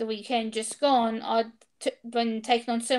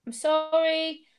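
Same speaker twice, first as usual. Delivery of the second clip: retold apology, slow and higher; apologetic; 5 semitones higher than usual. Apologising to the officer after being told to calm down, apologetic. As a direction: draw out the apology in a raised voice.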